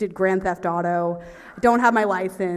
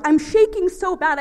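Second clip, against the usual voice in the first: high, loud fluster; frantic; 10 semitones higher, 7 dB louder than usual. Retold or imitated. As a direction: blurt it out in mock panic. Reliving nervous shaking.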